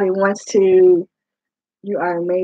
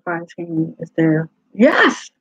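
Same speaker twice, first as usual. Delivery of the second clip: ordinary talk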